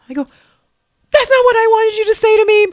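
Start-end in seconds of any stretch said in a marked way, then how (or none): 1.1-2.7 loud, high, exasperated cry; exasperated protest. Retold or imitated. Answering her pastor's challenge, a loud exasperated protest. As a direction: push back, much higher and louder.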